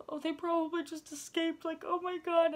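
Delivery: high, loud tearful wail; hammed-up panicky cry; imitated bawling.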